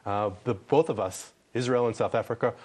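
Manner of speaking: ordinary delivery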